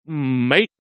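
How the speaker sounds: practising an Australian accent, drawn-out rising word. Shown as a demonstration. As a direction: try out an Australian greeting, tune rising. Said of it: "I've been practicing my Australian".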